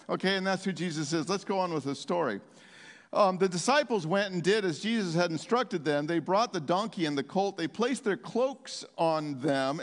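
ordinary delivery

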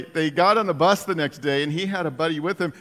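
ordinary talk, nothing marked

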